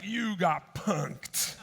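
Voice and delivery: very slow, stretched punchline; milk the prank reveal word by word; imitated prankster's slow gotcha; drawn-out reveal.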